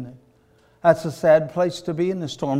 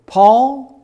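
angel calling; 9 semitones higher, 10 dB louder than usual. Acted. Angel calls Paul by name.

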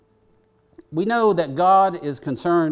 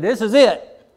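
loud, higher final warning; retold yell; 7 semitones higher, 8 dB louder than usual. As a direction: snap out the last warning. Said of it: "And I said".

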